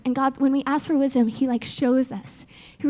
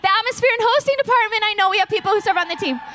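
excited; 6 semitones higher, faster than usual. Real, not acted. Excited about new department.

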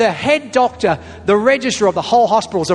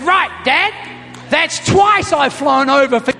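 higher, slow, half-shouted joking scold; mock exasperated threat; 6 semitones higher, slower than usual. Son scolds his father after second false alarm, mock exasperated. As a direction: pretend fury at his father.

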